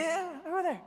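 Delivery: hammed-up lazy boss pointing; dismissive store owner. Store owner pointing to the phone, hammed-up high dismissive voice.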